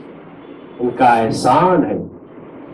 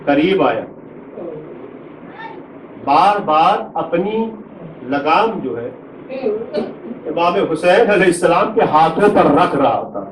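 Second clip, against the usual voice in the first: keen the tragedy aloud to draw the mourners to tears; masaib lament; 10 semitones higher than usual. Acted. Preacher to mourners at a majlis, high wailing lament.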